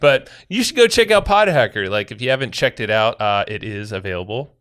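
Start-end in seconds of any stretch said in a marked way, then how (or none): 0.0-1.9 excited. Real, not acted. Excited plug for own product.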